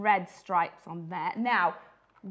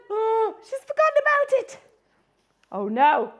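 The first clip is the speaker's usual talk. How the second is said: high, loud gasping alarm; alarmed granny; 10 semitones higher, 7 dB louder than usual. Acted. Granny remembers the turkey, high loud alarmed gasp. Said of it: "if our granny had different feelings".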